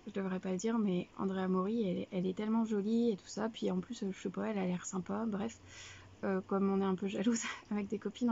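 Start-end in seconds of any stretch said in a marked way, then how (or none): none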